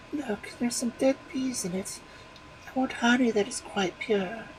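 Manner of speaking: high, picky wife voice; a demanding wife, finding fault; fussy wife. A narrator's reading. Wife rejecting her husband's honey, high and picky.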